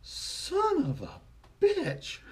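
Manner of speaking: very high, slow, drawn-out little voice; toddler copying correction. Retold or imitated. Little girl at her headless doll, slow high precise cursing. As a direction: squeak it slowly, then land the last word. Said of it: "she looks at it and goes".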